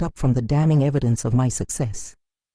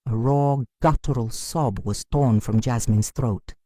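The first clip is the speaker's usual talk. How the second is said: ordinary delivery, nothing marked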